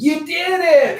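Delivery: put-on high, slow, delighted toddler cheer; a dad imitating his toddler son's tiny cheer; cute toddler voice. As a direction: squeal it like a proud two-year-old. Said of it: "cutest little Kingston voice".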